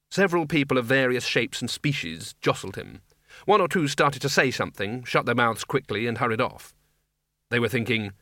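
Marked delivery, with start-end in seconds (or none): none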